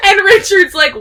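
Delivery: laughing. Real, not acted. Laughs at her own retelling.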